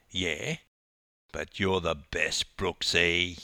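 ordinary delivery, nothing marked

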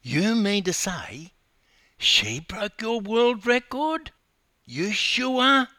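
high, loud, stretched-out disbelief; disbelieving. A narrator's reading. Husband checking his wife's lost record, disbelieving. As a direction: question it as if you can't believe it. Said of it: "said a disbelieving Robert".

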